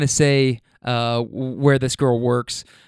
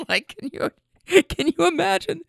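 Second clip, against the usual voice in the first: laughing disbelief; 14 semitones higher than usual. Real, not acted. Laughing at the story.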